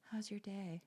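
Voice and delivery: soft imitation of a flirting man; hushed, put-on come-on; mock pillow talk. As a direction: murmur it like a posed pillow selfie. Imitates men posing in pillows.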